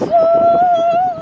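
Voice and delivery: loud high whoop; celebratory woo; cheer. Real, not acted. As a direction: let out a happy whoop. Cheering at campsite.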